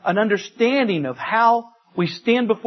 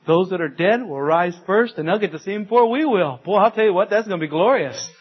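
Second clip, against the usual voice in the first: ordinary talk, nothing marked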